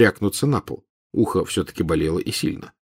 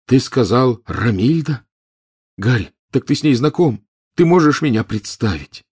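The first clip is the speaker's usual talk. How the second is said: eager hope; 4 semitones higher, 3 dB louder than usual. Acted. Ron eager hopeful.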